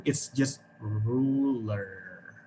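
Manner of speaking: ruler said slowly with a soft unrolled R; slow soft English R. Shown as a demonstration. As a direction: draw out ruler, R barely vibrating. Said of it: "It doesn't roll".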